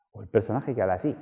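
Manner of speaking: a put-on character voice. Shown as a demonstration. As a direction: voice a different persona.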